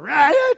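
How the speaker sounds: loud, high imitated child wail; mock crying scream. Pastor imitating a defiant child's scream, loud and high. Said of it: "crying and screaming in rebellion".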